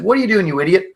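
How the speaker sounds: a touch of scolding; retold irritation. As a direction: act out an exasperated spouse mid-argument. Wife snapping in retold argument.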